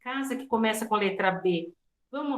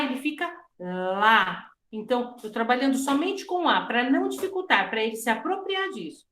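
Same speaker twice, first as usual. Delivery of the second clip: usual speaking voice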